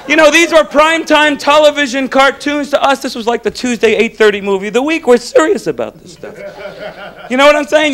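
usual speaking voice

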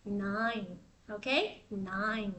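"Nine" said low and soft with a clear N. Shown as a demonstration. Teacher contrasting "Nine" with "night", low and soft. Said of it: "số chín mình sẽ đọc là nine".

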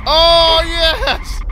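cheering. Real, not acted. Cheering win.

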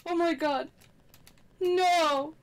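ordinary talk

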